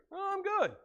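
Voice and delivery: a light, carefree thief; thief's voice. Retold or imitated.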